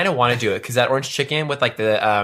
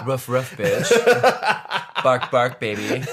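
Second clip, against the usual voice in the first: giggling through playful barks; laughing mock barks; much slower than usual. Cohost teasing pup-play fans, laughing mock barks. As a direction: bark it out while laughing.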